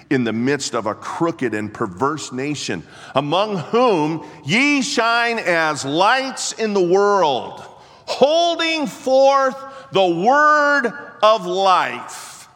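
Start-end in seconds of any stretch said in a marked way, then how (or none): 3.2-12.5 much higher, louder, slow preaching; preaching at full force. Acted. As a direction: ring it out to the rafters. Scripture climax preached.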